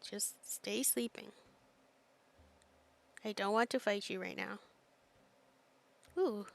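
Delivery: normal talking voice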